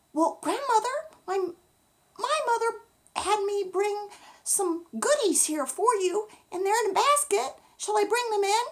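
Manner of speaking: put-on a small girl asking with concern; little girl voice; Little Red. Little Red speaks to grandmother.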